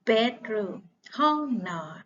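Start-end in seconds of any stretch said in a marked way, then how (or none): 0.1-0.8 "Bedroom" said louder with a falling end, as a model; clear model word. Shown as a demonstration. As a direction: model "Bedroom" crisply for young learners. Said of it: "นักเรียนของครูออกเสียงดังมาก". (ออกเสียง "Bedroom" ดังชัด เสียงตกท้ายคำ)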